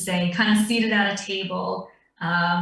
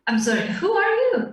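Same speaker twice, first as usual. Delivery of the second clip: surprised; 12 semitones higher than usual. Acted. Guild member questions stranger.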